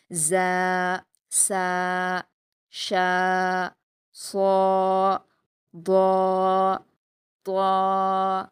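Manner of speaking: each letter from زا to یا held two beats with pauses; drawn-out letters. Shown as a demonstration. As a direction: chant the alphabet slowly for the class. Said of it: "اس طرح دو حرکات کھینچ کر پڑھیں گے". (ہر حرف دو حرکات کھینچ کر)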